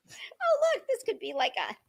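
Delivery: high delighted oh; excited squeal. Real, not acted. To the viewers about the bag, a high excited squeal.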